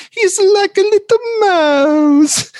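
very high, slow, teary mock-Italian voice; over-the-top Italian accent, very high; exaggerated Italian accent. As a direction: quote Paolo Gucci with a hammed-up Italian lilt. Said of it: "the accent that we all know and love is Paolo Gucci".

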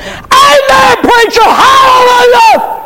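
high shouted church response; mock congregation shout. Preacher mocking men who shout amen, high shout. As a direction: holler it like a fired-up churchgoer.

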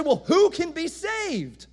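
high-pitched mock dismay, piling up reasons; dismayed bystanders. Commoners ask who can be saved, high-pitched dismay. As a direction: protest as a hopeless nobody. Bystanders acted protesting they are hopeless.

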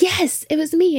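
high-pitched mock-argument insistence; insistent reveal.

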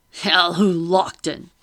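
loud, drawn-out hail; slow, carrying greeting; calling out. A narrator's reading. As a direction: hail him across the dock, slowly. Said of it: "called, waving his walking stick".

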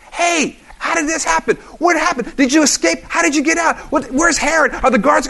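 high, excited crowd yelling questions; screaming questions. Retold or imitated. Crowd yelling questions at Peter on seeing him. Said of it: "Everybody at the same time is screaming out".

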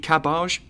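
CABBAGE said as cab-AHZH; mock French-style ending. Shown as a demonstration. Joking about why he says garage, CABBAGE pronounced ka-BAHZH. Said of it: "for the same reason I don't eat cabbage".

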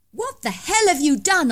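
high, loud shrieked accusation. Acted. Narrator shrieking at suspected murderer, high loud alarm. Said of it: "I shrieked".

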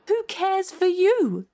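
high, slow scornful challenge; defiant. A narrator's reading. Alice standing up to the queen, defiant. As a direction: throw it back with swooping pitch.

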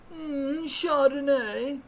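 high, slow, savoring mock relish; hammed-up fancy wine sipper; mocking indulgence. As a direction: draw it out high like a pampered diner. Mocking the rich diners' wine.

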